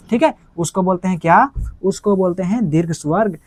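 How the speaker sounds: normal talking voice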